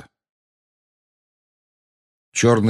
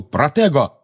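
raised, urgent cry; shouted spell; 7 semitones higher, 4 dB louder, slower than usual. A narrator's reading. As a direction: call the spell out, higher. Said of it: "Он крикнул". (выкрикнуть заклинание)

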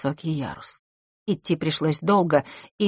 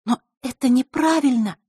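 astonished; 8 semitones higher than usual. Acted. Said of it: "изумилась Василиса".

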